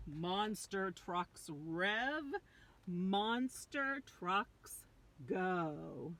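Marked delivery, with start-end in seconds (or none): none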